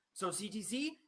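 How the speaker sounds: usual speaking voice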